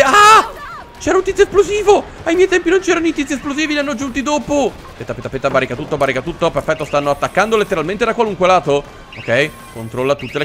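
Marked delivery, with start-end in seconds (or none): 0.0-4.7 high-pitched, indignant yelping; startled outcry. Real, not acted. Player surprised by an exploding zombie, startled outcry. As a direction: squeal in protest after a scare.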